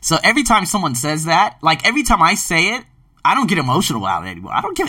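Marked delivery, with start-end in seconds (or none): none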